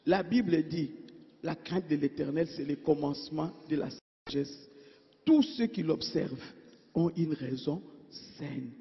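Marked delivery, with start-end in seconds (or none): 1.9-8.7 much softer, fading repeat of the verse; soft repetition. Acted. Preacher repeating the verse to his congregation, softly and fading. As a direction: let the verse sink in, hushed.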